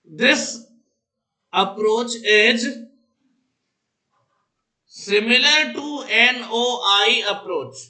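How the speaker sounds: very slow dictation with long gaps; dictating. Real, not acted. Teacher waiting for students to write, dictating very slowly. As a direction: give it out a chunk at a time.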